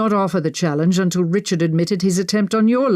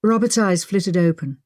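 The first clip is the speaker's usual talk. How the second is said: ordinary delivery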